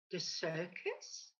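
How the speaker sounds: low, slow puzzled query with a rising end; puzzled question. A narrator's reading. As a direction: duck asking back in disbelief. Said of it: "the duck inquires".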